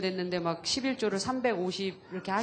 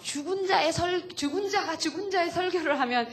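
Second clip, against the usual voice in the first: pushy retold urging; 6 semitones higher than usual. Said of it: "이러면서 제가 막 푸시를 했거든요".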